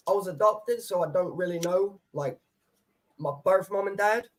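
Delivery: usual speaking voice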